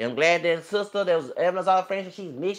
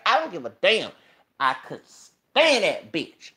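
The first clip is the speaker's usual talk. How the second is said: ordinary delivery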